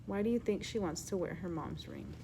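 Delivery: plain reading voice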